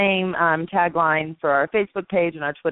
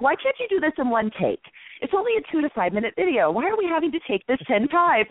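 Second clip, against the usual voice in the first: mildly exasperated, higher demanding voice; annoyed director pushing for one take; impatient director; 8 semitones higher than usual. Retold or imitated. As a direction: play the impatient TV producer, a bit higher. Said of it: "'Cause I'm kinda mean".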